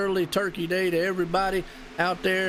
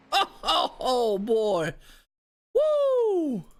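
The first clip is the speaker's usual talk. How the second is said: whooping after a wild finish; excited whoop; 4 semitones higher, much slower than usual. Real, not acted. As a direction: let out a big celebratory woo. Celebrating the finish.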